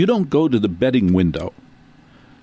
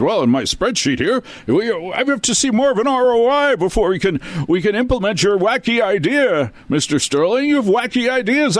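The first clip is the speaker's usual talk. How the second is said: much higher, mocking nasal-free prissy suit voice; pompous executive; 13 semitones higher than usual. Numbers-obsessed executive brushing off Mister Sterling, mock pompous and high. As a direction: lampoon a smug spreadsheet executive dismissing ideas.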